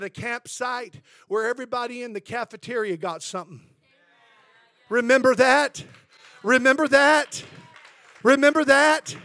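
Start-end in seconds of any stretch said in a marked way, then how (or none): 4.9-9.1 louder, rising call-out to the church; preaching call. Acted. Preacher asks the congregation to remember, rising loud. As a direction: rouse the crowd with each repeat.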